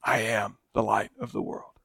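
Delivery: low and very soft, reverent; almost breathe it; hushed statement. Acted. Preacher voicing Jesus to the congregation, hushed.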